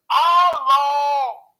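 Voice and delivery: "hallo" loud, high and drawn out; mock Dutch hallo. Comic teaching his first Dutch word, exaggerated drawn-out "hallo". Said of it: "If you don't pronounce them correctly".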